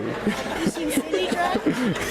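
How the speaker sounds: a high burst of laughter at himself; laughing at his own confession to the congregation. Real, not acted. Pastor laughing at himself before the congregation, high and bursting.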